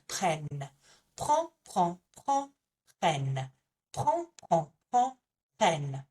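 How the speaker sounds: prends left open against prennent closed hard on the N; contrast drill. Shown as a demonstration. Teacher drilling the difference between prends and prennent. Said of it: "we're really closing that N sound".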